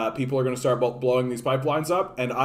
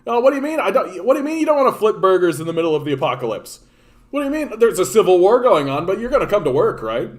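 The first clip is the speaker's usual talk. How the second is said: much higher, louder and faster, incredulous boss; sarcastic mock boss; 6 semitones higher, 6 dB louder, faster than usual. Mocking a boss who expects workers during apocalypse, incredulous. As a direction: play the clueless boss, shrill disbelief.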